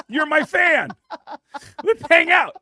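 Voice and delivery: high, loud excited call after them; overexcited shout. Retold or imitated. Eager fan shouting after a departing celebrity, high and loud. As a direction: yell it like a thrilled fan who won't let them go.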